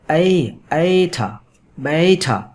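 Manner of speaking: slow, drawn-out glide 'a-i' in بیٹھا; diphthong demo. Shown as a demonstration. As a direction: stretch اے and بیٹھا slowly from 'a' to 'i'. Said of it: "اس کی دوہری آواز". (بیٹھا کی دوہری آواز آہستہ کھینچ کر)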